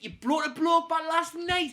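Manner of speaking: loud, high, drawn-out incredulous question; outraged disbelief. Retold or imitated. Scolding flatmate for bringing a bloke back, outraged. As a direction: explode slowly in high-pitched disbelief. Retold outraged reaction to confession.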